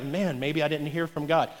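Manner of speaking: low, softened self-doubt; acted insecure aside, voice dropped low and soft. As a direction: mutter it as someone doubting themselves. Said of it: "get insecure and be like".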